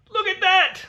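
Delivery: thrilled, pitch jumps way up; excited. Real, not acted. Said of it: "I don't know why I'm so excited".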